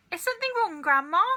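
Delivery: a high, innocent, overly polite question; boy politely asking his shocked grandma; asked politely. A narrator's reading. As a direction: play it sweetly innocent. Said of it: "George asked her politely".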